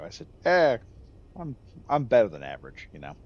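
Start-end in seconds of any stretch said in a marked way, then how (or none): 0.4-0.8 cocky understatement. Retold or imitated. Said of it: "that was my response". 1.4-2.9 plainer, offhand "better than average"; casual quip. Retold or imitated. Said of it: "that was my response". 2.9-3.2 cocky understatement. Retold or imitated. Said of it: "that was my response".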